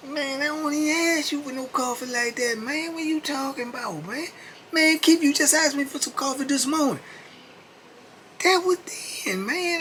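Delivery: very high put-on voice, protesting; Keith defensive. Keith denying he begs inmates for coffee, high and whiny. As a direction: protest in a squeaky whine.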